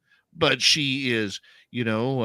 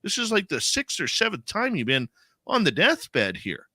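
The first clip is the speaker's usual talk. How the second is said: playful ribbing in a much higher voice; affectionate mock disbelief, pitched up; teasing; 9 semitones higher than usual. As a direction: tease her like she's indestructible. Teasing mom about many lives.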